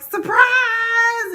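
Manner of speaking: high loud party "Surprise!"; surprise shout. Retold or imitated. The party guests yelling surprise at the aunt. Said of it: "everybody's like".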